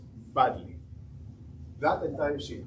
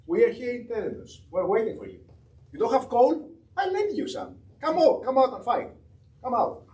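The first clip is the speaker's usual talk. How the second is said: higher, taunting come out and fight; provoking taunt; 7 semitones higher than usual. Retold or imitated. Admiral taunting the Ottomans by telegram, mock provocation. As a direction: goad the enemy to come out. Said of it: "He provokes them".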